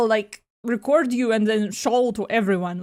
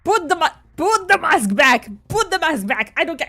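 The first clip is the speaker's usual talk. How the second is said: furious yelling; 7 dB louder than usual. Acted. Character screams at rescuer.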